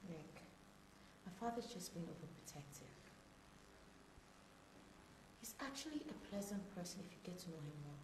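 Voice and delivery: soft, hushed reassurance; quiet placating. Acted. Daughter defends her father to her boyfriend, quietly placating.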